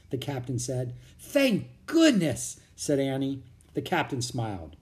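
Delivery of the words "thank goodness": high, loud, drawn-out relief; relieved. A narrator's reading. Girl learning the soldiers are patriots, relieved. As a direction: let relief burst out slowly.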